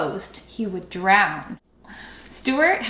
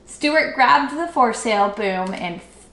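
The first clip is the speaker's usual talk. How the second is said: ordinary delivery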